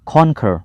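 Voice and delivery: teacher corrects himself, models कॉन्कर carefully; correct pronunciation. Shown as a demonstration. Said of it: "इसका सही pronunciation कॉन्कर".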